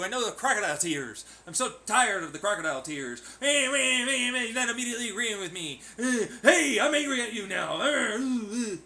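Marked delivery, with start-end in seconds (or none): 3.4-8.8 high, fast whiny mocking voice; mocking whine. Mocking a whiny critic, high nasal mock whine.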